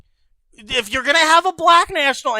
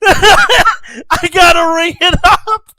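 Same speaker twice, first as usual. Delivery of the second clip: laughing; 6 semitones higher, 4 dB louder, slower than usual. Real, not acted. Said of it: "Voice crack alert!".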